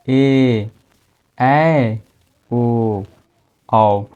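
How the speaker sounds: ए, ऐ, ओ, औ each held long; long vowels. Shown as a demonstration. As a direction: sustain ए, ऐ, ओ, औ one by one. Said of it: "ज़्यादा समय लग रहा है ना".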